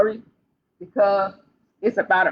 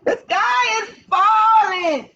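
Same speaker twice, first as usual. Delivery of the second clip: wailing chick; 9 semitones higher, slower than usual. Acted. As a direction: wail it like a scared little hen.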